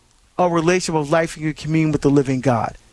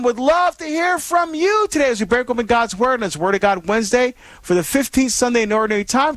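ordinary talk

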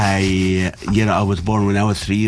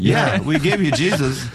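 laughing punchline; 6 semitones higher than usual. Real, not acted. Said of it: "repeats punchline".

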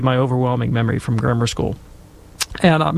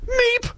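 a very high frightened meep; tiny squeak of shock. Retold or imitated. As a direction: squeak like a startled mouse. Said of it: "thinking, you know".

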